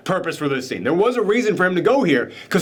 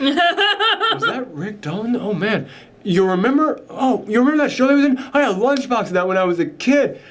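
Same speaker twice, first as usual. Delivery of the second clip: put-on higher, excited fan voice without the southern accent; excited cult member; 6 semitones higher than usual. Cult member recognizes childhood TV star, high excited voice. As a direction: redo it as a high-pitched starstruck fan. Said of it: "I don't know why I'm doing a southern accent for the cult members".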